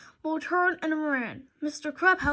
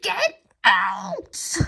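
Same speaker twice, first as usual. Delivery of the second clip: a louder, lower barked order; screamed; 6 semitones lower, 5 dB louder than usual. A narrator's reading. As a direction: throw them out, furious. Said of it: "Mister Krupp screamed".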